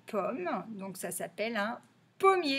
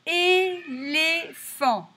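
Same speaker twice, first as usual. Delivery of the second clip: Éléphant slow, high and loud, É held long; very slow sing-out of Éléphant; syllable by syllable; 6 semitones higher, 6 dB louder, much slower than usual. Shown as a demonstration. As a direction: sound out É, LÉ, PHANT. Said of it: "chercher si on entend".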